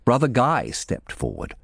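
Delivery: ordinary delivery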